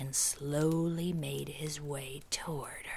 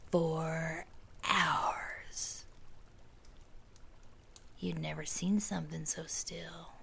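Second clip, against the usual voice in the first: spooky whisper. A narrator's reading. Spooky whispered campfire narration.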